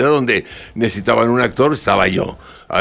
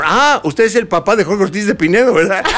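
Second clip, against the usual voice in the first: higher, laughing eager fan question; lightly higher mock fan voice, laughing; imitating the young women; 9 semitones higher than usual. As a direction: gush like surprised young fans. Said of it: "pasaron unas muchachas y me dijeron".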